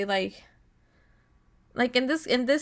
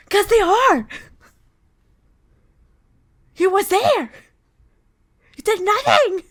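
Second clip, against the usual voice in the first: high, loud, outraged yelling; indignant shouting; 10 semitones higher, 8 dB louder than usual. Real, not acted. Yelling back at game character.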